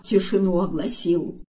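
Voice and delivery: plain reading voice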